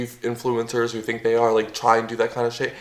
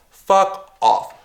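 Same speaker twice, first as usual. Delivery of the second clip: fuck off thrown out higher and harder, fuck punched; angry repeat; 6 semitones higher, 3 dB louder than usual. Real, not acted. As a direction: spit it out the second time, pitch jumping up.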